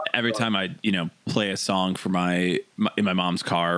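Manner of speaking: ordinary talk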